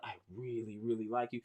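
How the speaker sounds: soft, hushed, lightly acted sweet-talk; mock tender confession. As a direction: murmur it like a shy crush, half joking. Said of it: "You're like".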